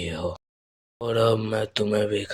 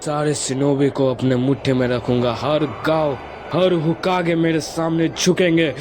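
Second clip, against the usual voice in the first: building to a high, raised proclamation; domineering declaration; 7 semitones higher, 3 dB louder than usual. Acted. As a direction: declare total rule, pitch climbing line by line. Villain declares he will rule all.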